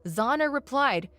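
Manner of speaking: plain reading voice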